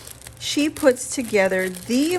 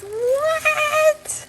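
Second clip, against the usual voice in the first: squealed in delighted surprise; a sudden high-pitched "What?" of delight; overjoyed squeak of surprise; 14 semitones higher, 4 dB louder than usual. Real, not acted. Surprised by gift contents.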